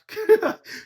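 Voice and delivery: sharp high burst of laughter; loud high laugh. Real, not acted. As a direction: cackle loud at the joke. Laughing at a bar.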